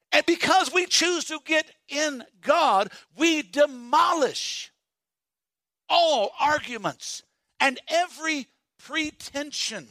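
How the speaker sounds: slow, high, building preacher's cry; preaching. Acted. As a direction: stretch each word out like a pulpit call. Preaching scripture at full force.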